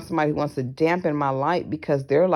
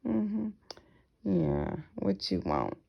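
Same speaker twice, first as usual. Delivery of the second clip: quieter, fake-polite thanks with a shift in voice; soft dismissive politeness; 3 semitones higher, 6 dB quieter, slower than usual. Brushing off a flatterer with a quiet fake-polite thank you. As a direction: brush them off politely but coldly. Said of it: "I'm like".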